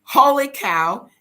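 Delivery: slow, loud holy cow; loud stretched exclamation; astonished. Real, not acted. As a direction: drag it out in amazement. Seeing the chicken coop.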